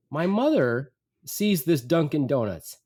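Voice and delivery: normal talking voice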